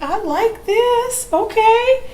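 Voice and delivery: high, slow, drawn-out approval; impressed approval. Retold or imitated. Telling the audience how she reacted to the program, impressed. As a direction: savour it like a delicious discovery. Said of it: "I'm like".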